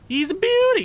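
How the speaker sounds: over-the-top delighted squeal; squealed praise. Acted. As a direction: gush it out in a big high squeal. Repeats praise, second time exaggerated.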